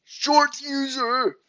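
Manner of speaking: very high, slow, goofy voice; squeaky-high drawn-out silly character; short user voice. Shown as a demonstration. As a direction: push it way up and drag it out. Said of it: "Do the short user voice".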